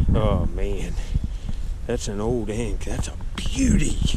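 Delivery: hushed, breathy with wonder; awed whisper. Real, not acted. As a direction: whisper it like a treasure. Awed whisper over ink bottle.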